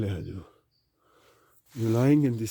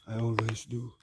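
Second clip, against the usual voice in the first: quiet; 4 semitones lower, 15 dB quieter than usual. Real, not acted. Drops to near whisper.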